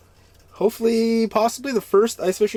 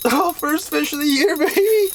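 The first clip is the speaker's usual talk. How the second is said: high, laughing cheer over the first catch; excited whoop; 9 semitones higher than usual. Real, not acted. First fish of the year caught.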